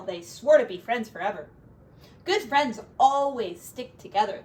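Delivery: gentle storybook narration; reading to children. Acted. Parent reading a picture book to a child, storybook voice. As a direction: read it aloud to a little one.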